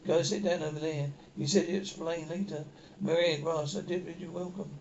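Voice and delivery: normal talking voice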